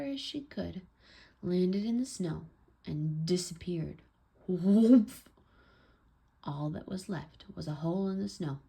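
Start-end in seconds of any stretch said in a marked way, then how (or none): 4.5-5.1 sudden, loud storybook whoomp; big narrated impact noise. A narrator's reading. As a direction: imitate a body plunging into snow. Girl lands in deep snow.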